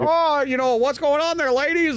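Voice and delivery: put-on lightly high-pitched friendly old man. Imitating the old manager.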